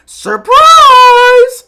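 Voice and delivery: very high, full-force drawn-out scream; yell. Acted. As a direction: yell it long and piercingly high. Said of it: "he yells".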